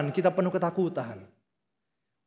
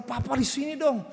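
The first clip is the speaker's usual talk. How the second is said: put-on higher, quick childlike pleading; a hint of a begging little boy; child's voice; 8 semitones higher than usual. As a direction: play the small kid asking to stay. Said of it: "anak saya bilang".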